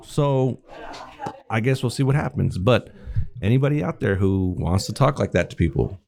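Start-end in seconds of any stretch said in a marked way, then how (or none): none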